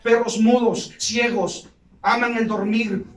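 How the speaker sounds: loud stern rebuke; the Lord condemning. Retold or imitated. The Lord rebuking sleeping servants, loud and stern. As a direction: thunder out God's condemnation.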